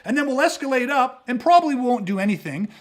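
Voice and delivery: ordinary talk, nothing marked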